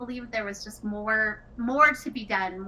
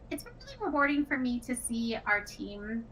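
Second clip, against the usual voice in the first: ordinary talk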